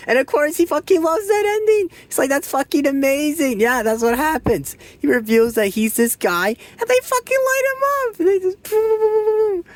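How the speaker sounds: can't contain the glee about the ending; excited retelling. Real, not acted. Reviewer gushing to viewers about the gunfire ending, high and gleeful.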